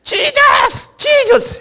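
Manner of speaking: very high, loud repeated cry; full-voice chant-like shout, far above normal pitch; imitated prayer shout. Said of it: "아프리카 사람들이 한국에서 주여".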